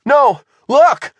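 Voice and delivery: high, loud, urgent correction; alarmed cry. A narrator's reading. Shivers points behind Bob, crying out in alarm. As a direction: cry out to make them turn.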